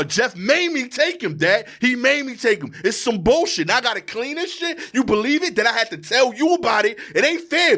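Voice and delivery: high-pitched, indignant venting at dad; heated rant. Retold or imitated. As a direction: re-enact an exasperated, worked-up complaint. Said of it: "I was so heated".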